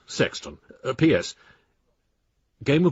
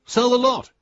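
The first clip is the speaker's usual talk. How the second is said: emphatic outburst; 11 semitones higher, 5 dB louder than usual. Acted. Exasperated friend urging a sale.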